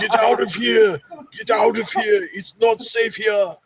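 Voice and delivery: hammed-up action hero warning; Arnold impression. Friend quoting Arnold's warning, laughing.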